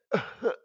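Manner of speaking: high barking cough; loud cough. Acted. Sick man coughs, sharp and high. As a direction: hack out one sharp cough. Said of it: "coughed Maximuffy loudly".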